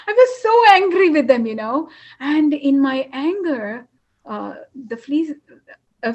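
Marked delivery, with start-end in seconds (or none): 0.1-1.5 raised, fast angry burst; angry outburst. Real, not acted. Telling a friend how furious the fleas made her. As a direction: blurt out the anger, loud and quick.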